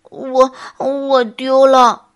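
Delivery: slow, halting, loud bear voice; sheepish bear. Acted. Bear admits a loss to the little animals, sheepishly. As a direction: stumble slowly over the words, embarrassed. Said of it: "笨熊不好意思地看了小动物们一眼".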